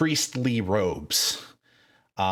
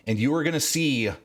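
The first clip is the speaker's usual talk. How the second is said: usual speaking voice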